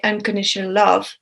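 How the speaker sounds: normal talking voice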